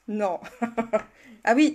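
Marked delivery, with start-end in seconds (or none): 0.5-1.0 short real giggle; quick chuckle; amused laugh. Real, not acted. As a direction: laugh at your own slip. Laughing at own mispronunciation.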